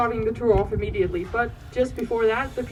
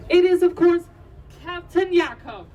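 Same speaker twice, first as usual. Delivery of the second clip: excited announcement; 6 semitones higher, 4 dB louder than usual. Acted. Announcing the captain to guests.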